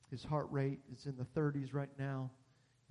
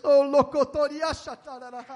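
speaking in tongues; 10 semitones higher, 14 dB louder than usual. Real, not acted. Glossolalia in prayer.

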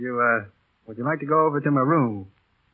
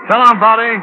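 much higher and louder, a ringing farewell; bigger goodbye; 8 semitones higher, 10 dB louder than usual. Acted. Pilot retrying his goodbye to Bonnie, now called out loud. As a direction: throw the goodbye out warmly this time. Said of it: "Let's try it again".

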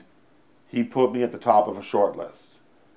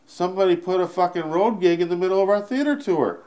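defensive retort; 9 semitones higher than usual. Retold or imitated. Comic answering Harlan on the plane, indignant. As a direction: protest back with heat. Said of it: "I said".

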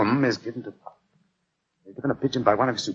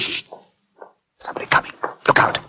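whisper; faster than usual. Real, not acted. Intruder approaching, hushing partner.

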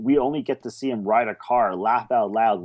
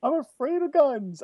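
high-pitched, over-the-top movie villain shriek; hammed-up panicked scream, pitched way up; mock scream; 5 dB louder than usual. Imitating the villain's reaction to the old lady's shotgun, screaming. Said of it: "screaming, he's like".

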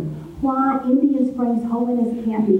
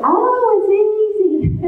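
put-on kindly old pastor; 13 semitones higher, 3 dB louder, slower than usual. Old pastor, smiling, answers her.

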